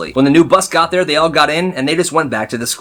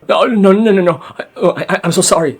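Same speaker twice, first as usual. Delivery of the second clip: panicked apology; 5 semitones higher than usual. Retold or imitated. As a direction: beg the huge guard in a panic. Bully panicking at the guard.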